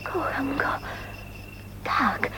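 hushed, breathless whisper of love. Acted. Woman confiding her new love, whispered. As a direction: whisper it like a secret you just discovered.

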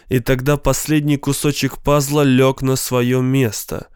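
usual speaking voice

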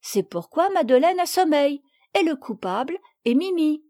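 a hint of an eager little girl reporting; young girl explaining. Little girl telling Madame why Madeleine is tired, eager.

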